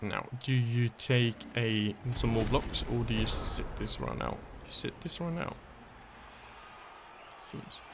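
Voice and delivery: ordinary delivery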